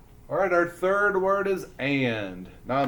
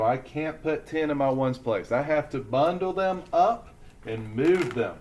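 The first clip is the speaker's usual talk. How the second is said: usual speaking voice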